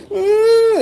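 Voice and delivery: very high, broken sob; imitated crying wail. Crying man under the taser, high sobbing wail. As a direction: wail like a grown man breaking down. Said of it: "he started crying".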